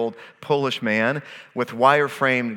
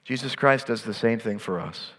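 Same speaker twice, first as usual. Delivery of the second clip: normal talking voice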